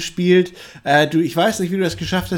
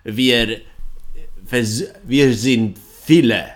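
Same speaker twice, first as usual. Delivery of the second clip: lower, slow Eastern European vampire voice; Hungarian vampire accent; 3 semitones lower, slower than usual. Acted. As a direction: play a broken-German vampire from Ungarn. Plays the half-vampire.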